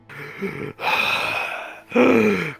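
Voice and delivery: pained groan. Acted. Dying man in video.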